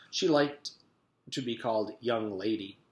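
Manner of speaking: ordinary delivery, nothing marked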